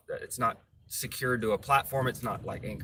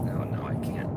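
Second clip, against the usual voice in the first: muttering under breath. Real, not acted. Tailgated while driving.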